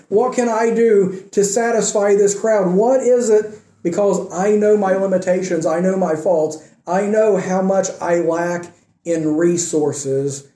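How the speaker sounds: ordinary talk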